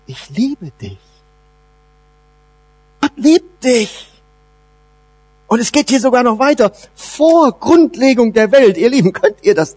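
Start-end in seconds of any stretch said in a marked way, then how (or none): 0.1-4.1 tender assurance. Real, not acted.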